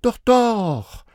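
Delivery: put-on high, loud child voices; children contradicting. A narrator's reading. Children contradicting their parents, high and insistent. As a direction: chirp back like small kids. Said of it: "widersprachen die Kinder".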